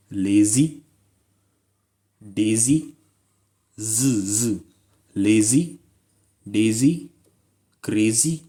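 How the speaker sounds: लेजी, डेजी and क्रेजी each ending on a buzzing z, with a rising tune; teacher drilling the z sound in लेजी, डेजी, क्रेजी; pronunciation drill. Shown as a demonstration. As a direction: repeat लेजी and डेजी with the z clearly voiced. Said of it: "last में क्या sound आई?".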